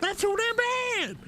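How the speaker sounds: high, slow shouted retort; meme voice. Acted. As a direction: drag it out high and loud. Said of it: "best prime example".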